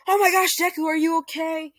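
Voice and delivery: high, loud panicked mom voice; worried mom. Retold or imitated. Mother Inko greets beaten son, high frantic worry. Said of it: "Inko runs to him, saying".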